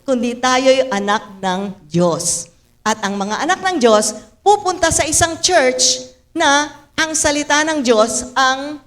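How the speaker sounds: ordinary talk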